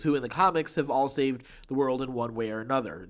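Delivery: ordinary talk, nothing marked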